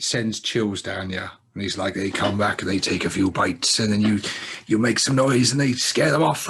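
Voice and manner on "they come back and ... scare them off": louder, rising imitation of Quint's accent; doing a rough Quint voice telling the tale; Quint impression. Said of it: "I'm trying to do his voice".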